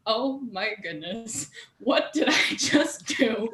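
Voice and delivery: slow, drawn-out dawning dread; playful retold horror; mock horrified realisation. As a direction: relive the "oops" moment slowly, rising. Reliving own horrified realisation.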